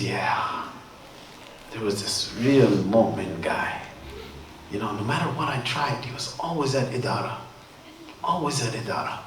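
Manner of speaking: low, subdued, weary reply; acted weariness, softly complaining at low pitch; tired Shaitan. Shaitan tells the alim about his hard day, wearily. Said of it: "Shaitan's really tired".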